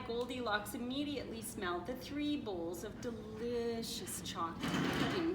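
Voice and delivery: ordinary delivery, nothing marked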